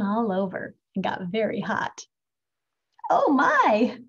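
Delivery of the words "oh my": sudden louder gasp of alarm; old woman alarmed. A narrator's reading. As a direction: catch the smell and startle. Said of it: "said the little old woman".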